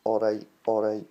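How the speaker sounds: "All right, all right" with a glottal stop instead of the T. Shown as a demonstration. Teaching Scottish speech, a glottal "all right". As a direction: swallow the T in "right". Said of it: "Just get".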